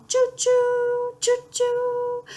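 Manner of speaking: high train whistle choo-choo. A narrator's reading. Reading to a child, a high train choo-choo. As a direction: sing out the train sound up high. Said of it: "He can go like a train".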